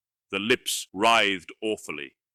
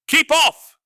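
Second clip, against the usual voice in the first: much higher, louder frantic scream; 11 dB louder than usual. A narrator's reading. Wizard Tsotha screaming at Conan to keep off, frantic shriek. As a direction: shriek it like a blood-mad jackal. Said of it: "screamed Tsotha like a blood-mad jackal".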